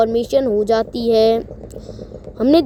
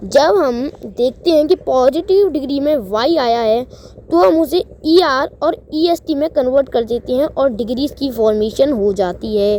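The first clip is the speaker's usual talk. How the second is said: ordinary delivery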